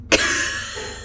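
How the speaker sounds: sharp voiceless gasp for air; sudden gasp. Acted. Dead-seeming fox coming to life, with a gasp. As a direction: gasp as if jolting back to life. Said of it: "the fox gasped to life".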